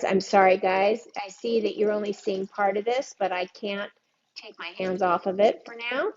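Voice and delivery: normal talking voice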